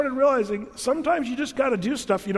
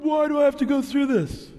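whiny complaint; 7 semitones higher, 3 dB louder than usual. Retold or imitated. Whining complaint to God.

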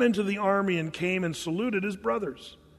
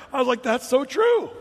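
loud, high delighted exclamation; amused agreement; 6 semitones higher, 5 dB louder than usual. Retold or imitated. Preacher reacting to the singer's answer, high amused agreement. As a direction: exclaim it through leftover laughter.

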